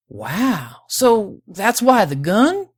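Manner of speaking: slow, drawn-out wonder, rising high and loud; impressed and incredulous accented prospector; amazed realization. A narrator's reading. Prospector amazed, works out the gun.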